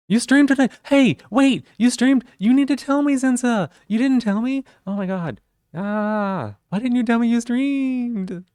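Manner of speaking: high, loud, shocked disbelief; shocked excitement. Real, not acted. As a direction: squeal it out in happy shock. Friend streamed without telling her.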